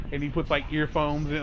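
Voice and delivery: ordinary talk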